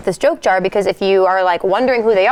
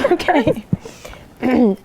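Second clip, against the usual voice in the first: ordinary talk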